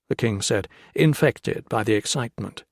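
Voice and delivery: ordinary delivery, nothing marked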